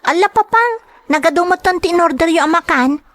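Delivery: put-on a little girl fussing over too much food; child voice. Child complains to father about the food.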